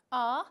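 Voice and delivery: "a" loud and held as an accented ending; stressed A. Shown as a demonstration. Teacher showing the third-person future ending, loud stressed "a". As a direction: hit a long, forceful "a". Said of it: "Todas las personas llevan acento".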